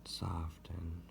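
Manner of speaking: hushed, near-whispered single word, long breaths between; soft repeated cue. Shown as a demonstration. Guide repeating a word for listeners to soften the belly, hushed. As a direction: let the word fall softly on the out-breath. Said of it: "repeat the word soften out loud".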